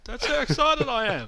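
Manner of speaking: excited high-pitched outrage at irrational people. Real, not acted. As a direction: squeal the disbelief up high with a laugh. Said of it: "I'm using my high voice".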